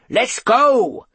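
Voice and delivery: loud, high yell. A narrator's reading. Mister Bunn starts the search party, yelling. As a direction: yell it to rally everyone. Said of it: "yelled Mister Bunn".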